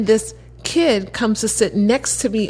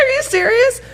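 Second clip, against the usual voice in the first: much higher, incredulous; can't believe the coincidence; stunned at his school story, pitched way up; amazed; 3 dB louder than usual. Retold or imitated. Disbelief at coincidence.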